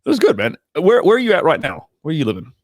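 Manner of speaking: usual speaking voice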